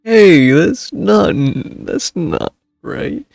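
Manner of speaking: ordinary delivery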